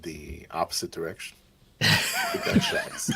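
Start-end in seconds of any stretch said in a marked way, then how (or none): 1.8-3.1 squeaky high laugh. Real, not acted. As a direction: crack up mid-word.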